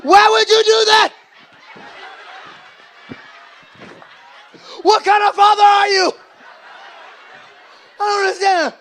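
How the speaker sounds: loud, high, hammed-up wailing protest; dramatic teen outrage. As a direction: scream at dad as if betrayed. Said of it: "Stop being so dramatic".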